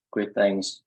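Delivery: ordinary delivery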